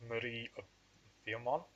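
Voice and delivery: name read in an appalling French accent; a mild, badly faked French accent. Shown as a demonstration. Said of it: "My, uh, French accent is appalling".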